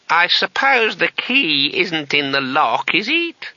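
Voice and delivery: put-on very high, slow incredulous old man; old wizard voice. A narrator's reading. Old wizard doubting the name through the door, high and slow. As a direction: stretch the name out in aged disbelief.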